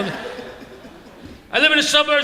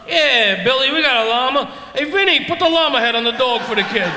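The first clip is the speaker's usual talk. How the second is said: Staten Island zoo guy; 4 semitones higher than usual. Retold or imitated. Zoo workers fake the llama.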